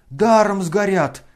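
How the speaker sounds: loud, slow, high roar. A narrator's reading. Crowd roaring from every side. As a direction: bellow in dismay. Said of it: "ревели со всех сторон".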